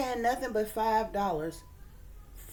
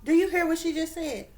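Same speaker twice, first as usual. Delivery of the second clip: high-pitched, incredulous question; 8 semitones higher than usual. Real, not acted. Asking viewers about the clip, incredulous. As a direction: press the question in disbelief, pitch up.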